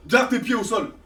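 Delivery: ordinary delivery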